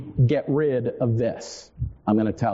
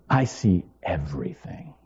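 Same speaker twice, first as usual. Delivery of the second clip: low voice; 9 semitones lower than usual. Retold or imitated. To his fussing kids, says it in a low, knowing voice. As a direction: drop low and claim it. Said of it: "And I'm like".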